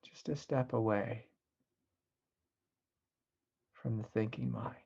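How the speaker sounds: soft, unhurried meditation voice with long pauses; guided meditation. Acted. Teacher guiding listeners away from the thinking mind, soft guided meditation. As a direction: ease them gently into stillness, softly.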